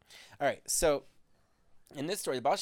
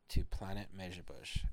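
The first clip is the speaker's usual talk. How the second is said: usual speaking voice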